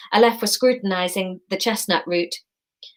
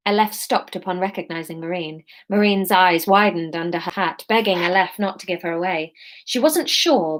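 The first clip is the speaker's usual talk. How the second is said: plain reading voice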